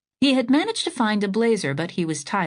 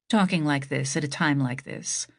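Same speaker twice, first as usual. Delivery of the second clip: usual speaking voice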